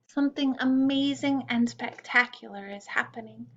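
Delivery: usual speaking voice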